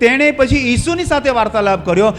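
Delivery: high, loud sermon delivery; full-force preaching. Real, not acted. Preacher tells congregation of thief addressing Jesus, full-force preaching. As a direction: proclaim it with rising force.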